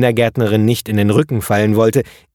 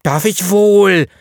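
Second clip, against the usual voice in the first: louder, high, slow and hurt; hurt child's shout; 10 semitones higher, 4 dB louder, slower than usual. A narrator's reading. Eddie shouting back at Leo, hurt and high. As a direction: yell back stung. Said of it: "schrie Eddie sichtlich betroffen".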